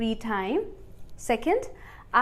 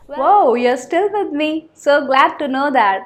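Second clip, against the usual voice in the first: louder, higher and slow, beaming at the viewer; delighted surprise; 3 semitones higher, 6 dB louder, much slower than usual. Real, not acted. Teacher praising viewers who stayed, delighted. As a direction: reward them for staying with you.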